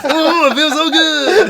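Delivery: high, slow gloating; mock gloating. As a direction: stretch it out smugly. Mocking the gloating.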